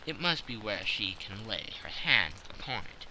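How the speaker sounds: plain reading voice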